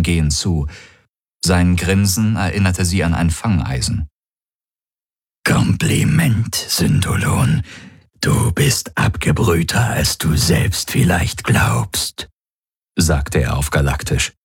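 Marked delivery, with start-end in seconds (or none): 5.4-12.3 slow, rasping creature voice; rough, voiceless, drawn-out menace; menacing alien predator. Acted. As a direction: savour each word as a grinning alien hunter.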